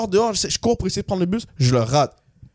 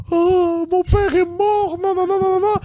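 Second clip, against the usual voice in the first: high wailing fake sob, much higher; mock crying; 13 semitones higher, slower than usual. Girlfriend fakes tears to guilt him, high mock wail. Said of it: "elle commence à pleurer".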